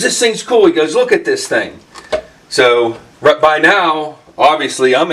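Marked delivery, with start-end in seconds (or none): 0.0-1.8 much higher, bursting; excited. Retold or imitated. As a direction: gush over the find. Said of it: "he's still all excited".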